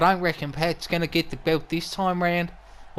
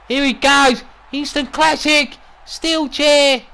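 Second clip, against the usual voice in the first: louder, higher, building to a ringside call; excited commentary; 8 semitones higher, 5 dB louder, slower than usual. Acted. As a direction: get swept up as the attack begins. Commentator excited as chair attack starts.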